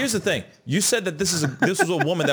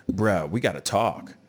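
ordinary talk

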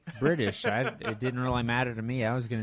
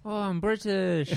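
higher, drawn-out fake British accent; bad British accent; 8 semitones higher than usual. Shown as a demonstration. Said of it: "really bad English accent".

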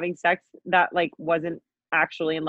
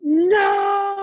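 high, drawn-out cry of no; gasp, then wail it in delighted horror; real horrified disbelief; 12 semitones higher, 3 dB louder than usual. Real, not acted. Listener learns he was covered in hickeys, appalled.

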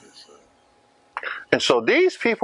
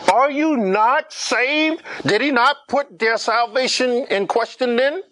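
impassioned preaching; 6 semitones higher than usual. Acted. Preaching at rising force.

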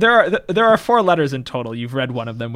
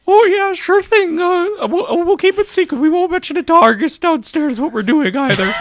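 high put-on NPC voice; 6 dB louder than usual. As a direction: pitch way up as a chummy NPC. GM voices NPC promising secrecy.

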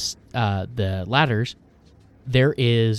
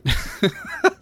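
high-pitched giggle; high laugh. Acted. Laugh in character.

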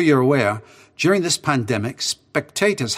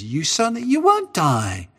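tempting serpent; 10 semitones higher, 3 dB louder than usual. Retold or imitated. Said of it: "He says".

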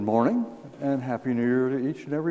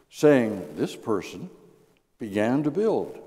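scripture reading. Real, not acted. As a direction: proclaim the verses to the congregation. Reads scripture aloud.

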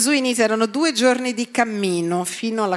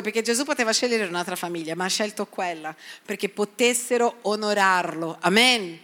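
normal talking voice

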